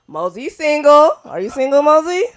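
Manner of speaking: calling out. Acted. Host teasing the chat about Mosey, loud call. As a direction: hype up the crowd about Mosey.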